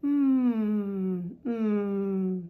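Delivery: two long, easy hums; relaxed M hum. Shown as a demonstration. Teacher correcting overdone lips, relaxed sustained hum. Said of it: "Just relax your lips".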